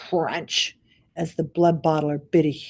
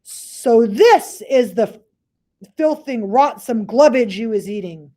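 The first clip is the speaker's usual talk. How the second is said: booming giant; 5 semitones higher, 6 dB louder than usual. Acted. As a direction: boom it out with disgust. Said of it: "boomed the blood".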